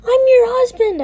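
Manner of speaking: angry spitting. A narrator's reading. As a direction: spit it out at your husband, outraged. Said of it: "I spat".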